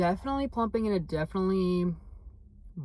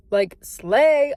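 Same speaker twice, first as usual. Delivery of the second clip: much louder slay; loud praise; 6 semitones higher, 11 dB louder than usual. Real, not acted. Praising her own look to the camera, loud. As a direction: belt out slay.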